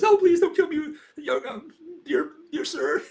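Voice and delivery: tiny high squeaky voice, whimpering for mercy; begging banana. Pretend. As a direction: plead for your life as a little banana, half laughing. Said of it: "Little banana says".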